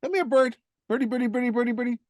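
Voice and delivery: sweet high birdie-calling voice; coaxing a wild bird. Acted. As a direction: coo at the bird to come over. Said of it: "just call her".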